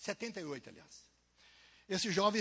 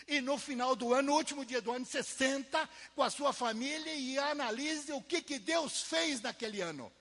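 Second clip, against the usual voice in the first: emphatic preaching; 8 semitones higher, 6 dB louder than usual. Acted. Preaching advice to congregation.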